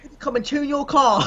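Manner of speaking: high, hammed-up Aussie voice breaking into laughter; badly faked Australian, on the edge of cracking up; awful Australian accent. Friend playing an annoying Australian festival host, mock Australian accent. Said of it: "that was an awful accent".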